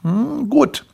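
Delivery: high-pitched appraising voice; tasting customer. Acted. Man tastes Grimpel's sample, high-voiced verdict. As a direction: judge the sample with a drawn-in hum.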